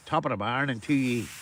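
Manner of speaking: a hint of the big man's voice; lightly imitated catchphrase. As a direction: toss off his catchphrase in a lightly put-on voice. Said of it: "the big man that says".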